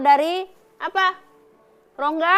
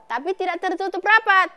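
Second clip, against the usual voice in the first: ordinary delivery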